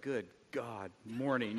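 mumbled groggy morning greeting; groggy grumble. Retold or imitated. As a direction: sound half asleep and grumpy. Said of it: "I wake up".

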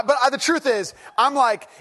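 usual speaking voice

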